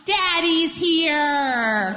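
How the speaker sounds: put-on drawn-out excited toddler call; toddler's shout. A narrator's reading. Toddler calling out at the mailman crossing the yard, drawn-out shout. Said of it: "shouted out".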